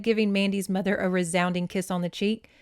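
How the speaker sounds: usual speaking voice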